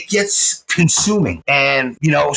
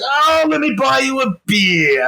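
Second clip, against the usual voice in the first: gushing; 9 semitones higher than usual. Retold or imitated. Fan offering his old teacher a beer, acted gushing. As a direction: swoop up high and stretch the offer out.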